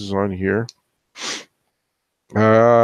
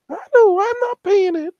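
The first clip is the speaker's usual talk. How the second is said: mock [name] voice. Refusing the contractor's bill in a high mocking falsetto. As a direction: squeak it out, cheeky and unbothered.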